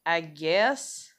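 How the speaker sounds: low, hesitant mock agreement; reluctant. Woman mimicking a reply to men's hints, reluctant.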